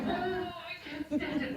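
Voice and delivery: high, hushed, laughing kid in mock despair; mock terrified child; scared kid voice. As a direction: whimper it like a spooked boy, laughing. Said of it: "he's going".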